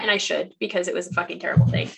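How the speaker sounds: normal talking voice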